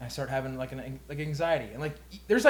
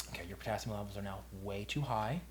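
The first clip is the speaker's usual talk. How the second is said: retold doctor; 6 semitones lower, 12 dB quieter than usual. Retold doctor giving bad news.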